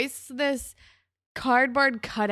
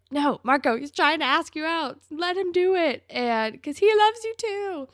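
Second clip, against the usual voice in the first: high, loud mock pleading; exasperated urging; 8 semitones higher, 5 dB louder than usual. Viewer urging Marco to accept the date, exasperated pleading. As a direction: plead with him, climbing higher and louder.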